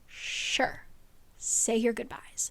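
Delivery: a quiet, chilling brush-off; cold villain reply. Retold or imitated. Villain Rigolis answers Bryce.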